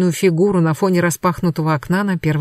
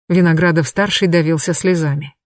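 ordinary delivery, nothing marked

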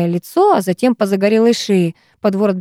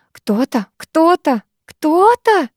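each repeat climbing higher in pitch; indignant repeating; 8 semitones higher, much slower than usual. A narrator's reading. Girl parroting his excuse back, indignantly. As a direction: keep harping on it, more outraged each time. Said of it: "возмущённо заладила я".

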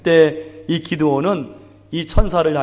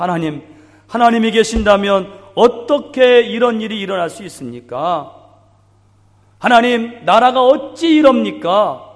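rising lament; 6 semitones higher, 3 dB louder than usual. Retold or imitated. Voicing people's complaints to God.

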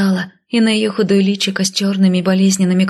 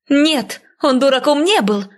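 contained shout of protest; indignant cry; 7 semitones higher than usual. A narrator's reading. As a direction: defend the father with rising passion. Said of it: "закричала я".

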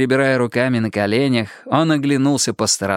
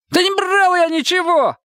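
very high, thin protesting voice; thin cry. A narrator's reading. Detainee denying the theft to police, thin high-pitched cry. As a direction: cry out shrilly in denial. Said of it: "тонко выкрикнул он".

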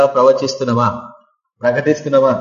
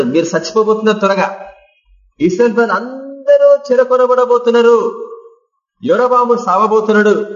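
high, ringing proclamation of doom; bold prophecy; 7 semitones higher than usual. Acted. Amos in Jerusalem, warning the people in a high ringing cry.

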